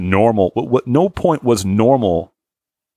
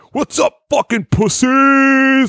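much higher, slow hammed-up bro shout; macho bro; 3 dB louder, slower than usual. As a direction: burst in like a cocky jock. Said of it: "kick a fucking door down and be like".

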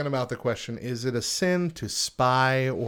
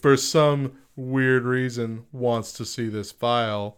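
mock FBI agent; 7 dB louder, slower than usual.

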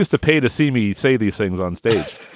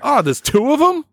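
mock surprise; 13 semitones higher than usual. Improv discovery of strange part.

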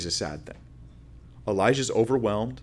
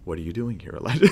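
sober voice that demands his attention; really serious; faster than usual. Acted. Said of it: "in a really serious way".

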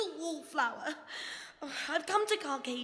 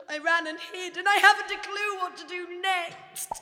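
louder, higher flustered rush; nervous panic; 6 semitones higher, 8 dB louder than usual. Acted. Panicking, spores going off.

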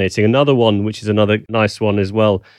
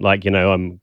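ordinary talk

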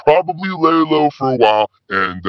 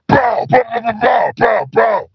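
gunshot noises; 9 semitones higher than usual. Acted. Caller imitates gunshots.

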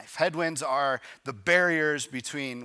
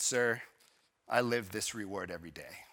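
softer and lower, gently content; a quiet, smiling answer; 5 semitones lower, 7 dB quieter than usual. Retold or imitated. Content man gently answering the busy man, softer and smiling. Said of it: "the content man smiled".

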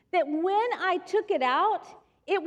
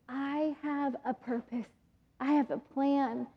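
tender promise; 9 dB quieter than usual. Retold or imitated. Voices Jesus' promise.

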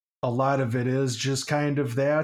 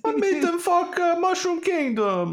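much higher squeaky Mario voice, slow. Pretend. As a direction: pitch it way up like Mario. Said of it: "All I got is Mario".

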